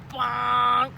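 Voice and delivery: bonk. Acted. Bump sound effect.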